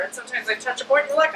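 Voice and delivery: ordinary delivery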